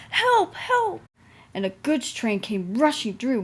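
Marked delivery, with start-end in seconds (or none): 0.1-1.0 high, loud, panicky whistle-cry; frightened cry for help. A narrator's reading. James cries for help as trucks push him. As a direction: shriek it like a runaway engine. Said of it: "heard an engine whistling".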